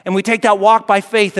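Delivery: ordinary delivery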